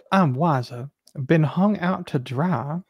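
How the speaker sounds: normal talking voice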